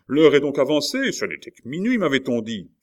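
excited exclamation. A narrator's reading. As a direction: exclaim at the early signal. Maurevel startled by the signal. (exclamation agitée, voix montée)

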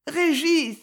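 put-on high, plaintive little-girl call. A narrator's reading. Frightened little girl calls her big brother, plaintive. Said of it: "dit-elle d'une voix plaintive".

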